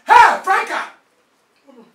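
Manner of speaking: loud furious yelling at his wife; angry shout. Acted. Husband catches wife breaking the fast, furious shout. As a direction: bellow at her for eating during the fast.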